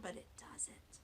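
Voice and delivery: secret hint whispered. Real, not acted. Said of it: "hint about tricky word".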